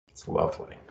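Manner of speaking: breathy hushed whisper; a whisper of contentment; whispered lovely. Real, not acted. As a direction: let it out softly, savoring it. Savoring the hug release.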